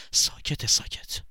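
whispered warning to be quiet; whispered hush. Acted. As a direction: whisper ساکت urgently. Hushing someone.

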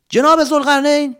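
high loud call into the cave. Acted. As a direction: call out to find him. Calling out in cave.

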